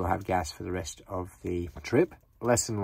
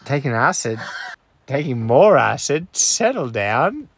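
laughing; 6 semitones higher, 8 dB louder than usual. Real, not acted. Joking, laughing in the car.

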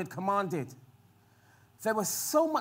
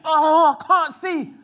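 panicked cry; 5 semitones higher, 8 dB louder, slower than usual. Retold or imitated. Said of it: "All of the men".

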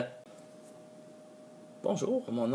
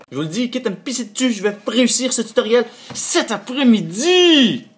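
much higher and louder, over-the-top resolve; hammed-up determined vow; 12 semitones higher, 14 dB louder than usual. Creator vowing to finish his tutorial, hammed-up heroic declaration. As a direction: ham it up like a hero swearing an oath.

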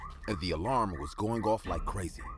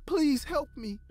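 breathless fright; 12 semitones higher, 14 dB louder than usual. Acted. Frightened woman in the story.

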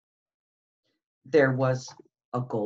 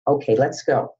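ordinary delivery, nothing marked

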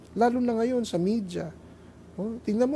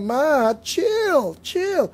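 put-on higher pitched, casual teen chill; teen brushing off mom; 7 semitones higher, 5 dB louder, much slower than usual. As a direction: toss it off like a bored teenager. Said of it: "babanatan yung nanay nila".

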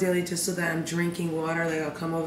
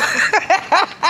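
high loud burst of laughter; laughing; 12 semitones higher, 8 dB louder than usual. Real, not acted. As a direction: laugh at your own song. Laughs after singing.

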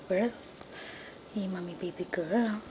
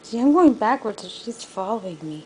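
louder; 7 dB louder than usual. Real, not acted. Louder retake of same point.

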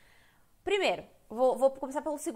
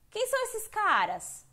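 high, slow curious question; playing a girl asking about the guys; 10 semitones higher, 3 dB louder, slower than usual. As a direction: wonder aloud who these guys are. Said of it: "por exemplo".

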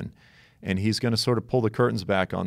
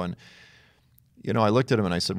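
ordinary talk, nothing marked

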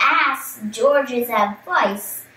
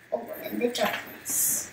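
breathy story whisper for the dark; 12 dB quieter than usual. Acted. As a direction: hush it like a secret in the dark. Spooky line in story.

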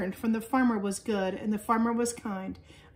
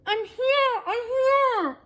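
tiny high voice; 14 semitones higher, 4 dB louder, much slower than usual. A narrator's reading. Seed calling from underground.

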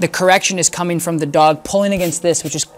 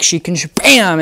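ordinary delivery

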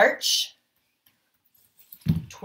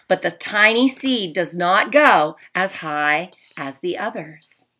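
storybook read-aloud, a little louder, with hushed asides; reading to children; 5 dB louder than usual. Acted. Teacher reading The Tiny Seed to her class. Reads picture book to class.

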